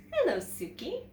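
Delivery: a lightly voiced kind teacher; friendly teacher. A narrator's reading. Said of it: "said Missus Paggio".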